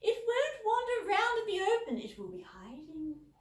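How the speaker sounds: put-on high, loud, sulky posh girl; pouting teen. A narrator's reading. Elder sister sulking to Dad about missing Megan's weekend, high and pouting. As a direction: whine it with a pout.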